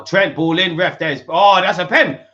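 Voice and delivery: building from urgent to a shout as he sees the penalty; rising excitement. Real, not acted. Fan watching a penalty given, building excited shout.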